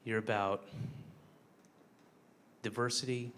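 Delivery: put-on low, soft, earnest speech to a child; quiet, tender plea to the kiddo; tender heart-to-heart. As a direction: speak gently and sincerely to the kid.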